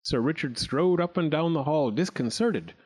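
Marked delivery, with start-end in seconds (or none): none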